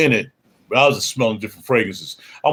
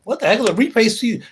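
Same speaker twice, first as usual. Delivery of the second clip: high puzzled "Reface C P" asked by a viewer; confused viewer voice; 10 semitones higher than usual. Pretend. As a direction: play the baffled viewer asking "what the heck is a Reface C P". Voicing confused viewers' question.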